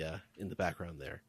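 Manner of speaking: ordinary delivery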